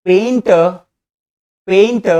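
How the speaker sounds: पेंट said as "pain-tuh" for painter, high; silent r. Shown as a demonstration. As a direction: say पेंट as painter without final r. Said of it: "silent हो रहा है".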